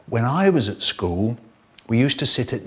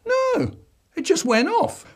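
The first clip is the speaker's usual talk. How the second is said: put-on high whiny kid voice; boy pleading innocence to his dad; child voice. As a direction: squeak it out like a guilty little boy. Child denying again.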